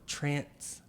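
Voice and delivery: "transends" split into "trance... ends"; slowed down. Shown as a demonstration. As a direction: slowly break "transends" into two words, trance and ends. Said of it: "Slow that one down".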